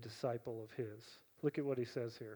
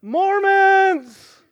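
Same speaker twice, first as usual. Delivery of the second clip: sudden, much higher, louder heckle; yelled out; 17 dB louder than usual. Retold or imitated. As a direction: shout it from the back of the hall. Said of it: "somebody yells out".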